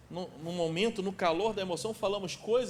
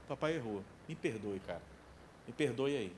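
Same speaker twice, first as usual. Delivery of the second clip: gentle apology; 10 semitones lower, 8 dB quieter than usual. Retold or imitated. Dad saying sorry to his kids, soft gentle apology. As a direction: lower and softer, a light humble sorry.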